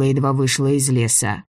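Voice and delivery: ordinary delivery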